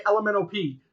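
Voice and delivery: L M N O P slurred together like the alphabet song; quick run-together L M N O P; fast, with rhythm. Shown as a demonstration. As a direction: rattle off L M N O P as one word. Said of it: "fast and with, like, a rhythm".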